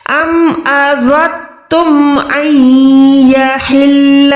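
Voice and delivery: slow chant with nasal ghunna on अन यहिल्ल; recitation with ghunna. Shown as a demonstration. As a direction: recite slowly, nasalizing the नून. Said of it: "आवाज को नाक में ले जाएंगे". (गुन्ना के साथ तिलावत)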